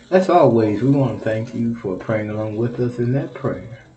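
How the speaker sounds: normal talking voice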